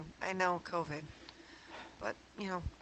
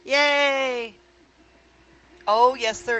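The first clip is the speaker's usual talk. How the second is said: excited; 4 semitones higher, 10 dB louder, much slower than usual. Real, not acted. Sees hexagon infill appear.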